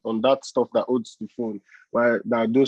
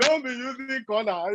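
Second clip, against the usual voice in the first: mock tough talk; 11 semitones higher than usual. Mock street talk to Ada.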